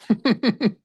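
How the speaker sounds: short, high burst of laughter; loud, quick giggle in bursts; real laugh. Real, not acted. Host laughing at own admission about making out, real high laugh.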